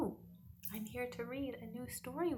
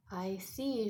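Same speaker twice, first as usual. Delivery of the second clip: usual speaking voice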